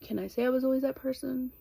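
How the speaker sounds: ordinary talk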